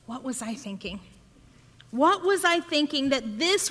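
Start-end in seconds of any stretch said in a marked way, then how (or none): 0.0-1.0 muttered regret. Retold or imitated. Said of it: "And you sit and you go".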